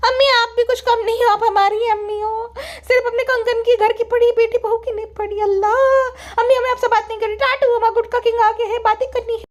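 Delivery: high, whiny sulk at mother; sulking daughter. Acted. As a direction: play an offended daughter in a high voice. Daughter sulks. (रूठी बेटी की ऊँची आवाज़)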